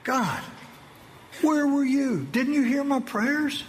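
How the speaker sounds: high, pleading shout at heaven; angry cry to God. Retold or imitated. Soldier cries out to God after losing his men, high anguished shout. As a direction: fling the question up at God in anguish. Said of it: "I was angry with God".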